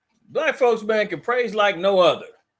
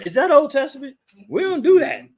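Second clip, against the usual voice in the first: high, louder imitation of an objecting churchgoer; mock dismissal; 8 semitones higher, 6 dB louder than usual. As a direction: brush it off in a parody voice. Mimics churchgoers' dismissive retort.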